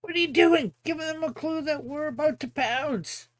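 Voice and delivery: high-pitched scolding cartoon voice; high, indignant squabbling; Extendo. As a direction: scold a teammate in a high character voice.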